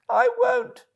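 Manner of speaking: very high, meek Toad voice; playing the humble washerwoman; Toad disguised as washerwoman.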